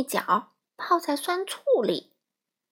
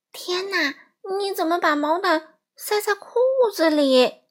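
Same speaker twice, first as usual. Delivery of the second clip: high, louder cry of disbelief; aghast, raised-voice question; shocked mother; 7 semitones higher, 4 dB louder than usual. Acted. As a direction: exclaim like an astonished mum, pitch up. Startled mother finds blanket in pants.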